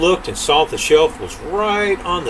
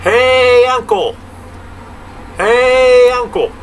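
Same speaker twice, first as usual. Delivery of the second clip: long, high, loud holler on HEY; drawn-out holler, far louder and higher than speech; hollered call; 10 semitones higher, 5 dB louder, slower than usual. Acted. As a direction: call across a great distance, vowel held out. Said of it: "hollers out".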